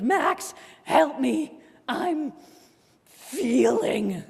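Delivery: high, loud hammed-up plea with a laugh; mock dramatic cry.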